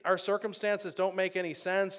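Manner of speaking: usual speaking voice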